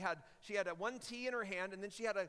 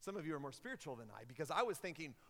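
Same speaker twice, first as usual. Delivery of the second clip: normal talking voice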